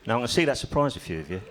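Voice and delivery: ordinary delivery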